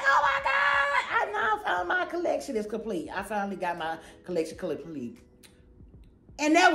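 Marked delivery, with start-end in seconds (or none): 0.1-3.1 overjoyed shriek. Retold or imitated. Hearing the mirror was found, high delighted shout. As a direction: squeal it out, thrilled. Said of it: "I was like".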